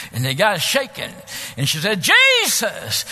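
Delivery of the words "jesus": high, loud ringing shout; bolder still. Retold or imitated. Young woman commanding the shaking intruder, bold high shout. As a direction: shout the name with full boldness. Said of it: "Now she's getting bolder".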